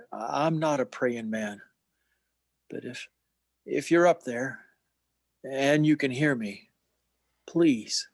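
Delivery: a broken man praying for the first time; desperate prayer. Acted. George prays at the bar.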